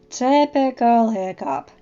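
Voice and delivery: loud, slow grumble; drawn-out, irritated complaint; grousing. A narrator's reading. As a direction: grouse it out slowly and loudly. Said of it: "Snotlout groused".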